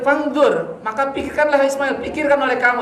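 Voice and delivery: a father coaxing his son to think it over; Ibrahim's voice. Acted.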